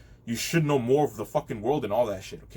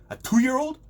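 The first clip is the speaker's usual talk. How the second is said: incredulous; 10 semitones higher, 5 dB louder than usual. Real, not acted. Incredulous rant about parents.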